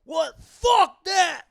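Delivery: high, loud outraged refusal; imitated defiant shout. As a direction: yell it as the defiant bartender. Bartender defiantly rejects advice.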